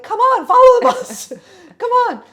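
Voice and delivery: high, loud exasperated urging, breaking into a laugh; half-laughing, furious pleading; fuming mad. Retold or imitated. Watching the bus pull away from her friend, loud laughing exasperation. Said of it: "And just fuming mad".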